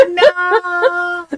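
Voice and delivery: high, loud wailed mock protest; mock dismay. Friend recalls losing a favorite movie scene, wailed mock dismay. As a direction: cry out in exaggerated, tearful dismay.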